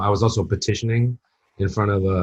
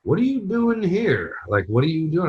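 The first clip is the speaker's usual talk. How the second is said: higher, slow, puzzled question; baffled stare; 8 semitones higher, slower than usual. Retold or imitated. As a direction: drawl it out in confused disbelief. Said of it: "You could tell by looking in their eyes".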